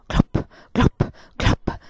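clop clop voiced as a breathy knocking heartbeat; whispered heartbeat knocks; imitated heartbeat thump. A narrator's reading. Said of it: "cuore che fa glop".

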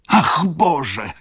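loud, high cry from the baroness; horrified cry. A narrator's reading. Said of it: "krzyknęła znowu pani baronowa". (wysoki, głośny okrzyk baronowej)

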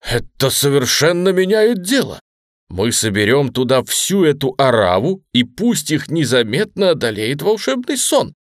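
loud, high burst of excitement; excited exclamation. A narrator's reading. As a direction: exclaim it eagerly, pitch climbing. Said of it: "Ружера воскликнул".

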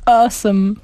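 mock American "awesome"; American reply. Host playing the other American's reply, mock American accent. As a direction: answer like a gushing American. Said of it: "osoba pewnie by odpowiedziała".

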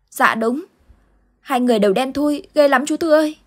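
put-on a small boy still shaken by the nightmare; frightened little boy. Boy answering Uncle Tư about the dark figures, frightened child voice. Boy's voice held.

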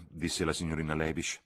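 ordinary delivery, nothing marked

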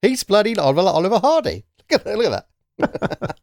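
high, excited disbelief at a funny photo; pointing it out with gleeful amusement. Real, not acted.

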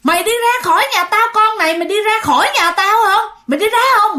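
high, loud furious yell; grandma yelling at the ghost to get out. Retold or imitated. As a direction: drive the ghost out of the house with a shout. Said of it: "vừa đánh mà vừa la".

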